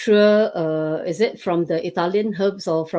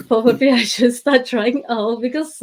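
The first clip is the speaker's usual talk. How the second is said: laughing through the words, higher and louder; 6 semitones higher, 5 dB louder than usual. Real, not acted. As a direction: giggle as you repeat it. Laughing retake of same phrase.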